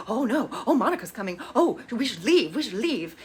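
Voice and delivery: swooping, hammed-up fretting; mock panic. [name] hearing Monica's coming, mock panicked. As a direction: flutter through a pretend panic, pitch jumping about.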